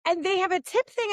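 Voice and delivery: ordinary talk, nothing marked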